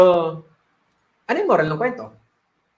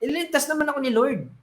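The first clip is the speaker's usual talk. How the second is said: higher, exasperated brush-off; irritated snapping at the rescuers; annoyed; 6 semitones higher than usual. Acted. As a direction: shoo them away, fed up. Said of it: "Nainis siya".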